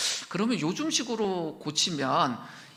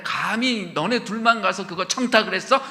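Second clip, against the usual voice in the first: louder, resentful jab; jealous outburst; 4 semitones higher, 7 dB louder than usual. Acted. Ten disciples resenting James and John, mock jealous. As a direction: snap at them out of envy.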